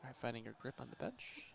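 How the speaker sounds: hushed, soft golf announcer voice; whisper-soft golf broadcast voice; golf commentator. Pretend. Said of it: "just do the golf commentator's voice right now".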